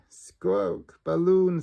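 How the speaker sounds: squawky parrot repeating a word. A narrator's reading. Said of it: "says Polly Parrot".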